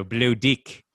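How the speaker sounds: Kiwi accent, Below Deck said like dick; New Zealand accent. Shown as a demonstration. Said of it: "I'm doing it with the New Zealand accent".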